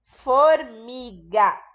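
Formiga stretched very slowly, syllable by syllable; syllable-by-syllable dictation. Shown as a demonstration. Teacher reading the word while kids fill gaps, very slow. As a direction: draw each syllable out for young writers. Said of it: "Então, completa lá".

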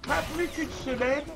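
high-pitched, hammed-up romantic recital; declaimed love poem. Gamer reading love letter to Zygmunt mid-fight, theatrical declamation. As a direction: declaim it like overblown love verse. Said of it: "on va lire ça à haute voix".

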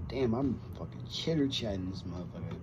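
usual speaking voice

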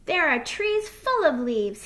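a hint of a singing little fox; lightly singsong. A narrator's reading. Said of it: "he sang".

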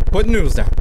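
sudden loud high shout; loud frustrated yell at the game; yelled command. Real, not acted. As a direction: bark it out in a burst. Yelling at game to drop noodles.

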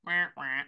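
low sad-trombone wah-wah; deflating wah-wah noise. Acted. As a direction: imitate a comic letdown horn. Mimes deflated return to reality.

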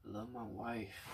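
very soft, slow movie-character voice; movie character impression. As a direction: murmur it like a film captive. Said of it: "I sound like that guy".

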